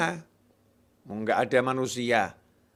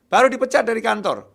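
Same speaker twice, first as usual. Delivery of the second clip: emphatic declaration; 8 semitones higher, 6 dB louder than usual. Acted. Preacher stresses the firing again.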